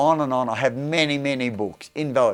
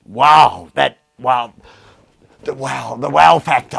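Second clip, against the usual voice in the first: loud wow; 9 dB louder than usual. Real, not acted. Marvelling at the books.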